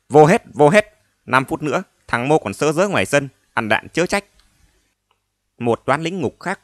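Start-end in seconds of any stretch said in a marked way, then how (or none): 0.1-4.2 loud barking guard orders; guard shouting. Acted. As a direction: yell orders at the prisoners. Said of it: "la hét quát nạt".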